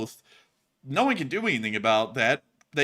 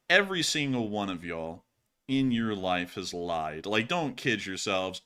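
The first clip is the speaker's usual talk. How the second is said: ordinary talk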